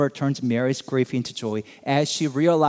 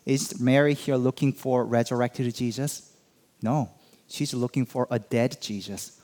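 ordinary delivery

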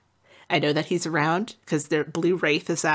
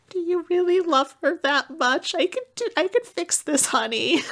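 much higher, coaxing motherly voice; sweet old mage; 11 semitones higher than usual. Retold or imitated. Old mage Wynn offering to save the son's beloved, high and coaxing. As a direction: coax him gently, very high and sweet. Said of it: "and Wynn's like".

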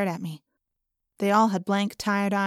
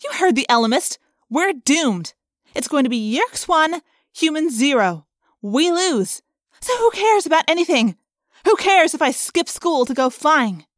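raised, exasperated arguing; heated defiance; 7 semitones higher, 6 dB louder than usual. A narrator's reading. Rachel arguing that they are doomed, heated and high. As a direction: argue back hotly, pitch climbing. Rachel argues back at Jake.

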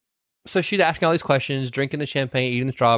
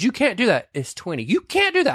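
tag; 8 semitones higher than usual. Retold or imitated. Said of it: "And he goes".

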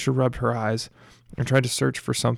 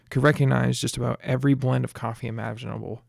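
usual speaking voice

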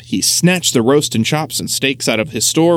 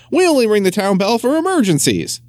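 high-pitched, affronted official reprimand; indignant scolding; 8 semitones higher than usual. A narrator's reading. Mayor scolds children over the bell.

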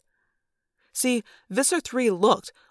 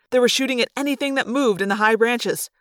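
ordinary delivery, nothing marked

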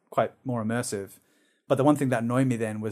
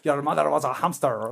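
put-on silly French-accented taunt; exaggerated French accent; comic French accent. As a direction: taunt like a farcical French knight. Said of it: "but with a French accent".

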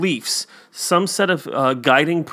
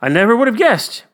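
higher, louder mock amazement; amazed; 6 semitones higher, 5 dB louder than usual. Man in the fable shown his own blood, amazed. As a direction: play the astonished man, quick after the wow.

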